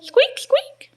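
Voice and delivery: very high little squeaks; high-pitched playful mouse imitation. Shown as a demonstration. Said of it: "Mice go squeak".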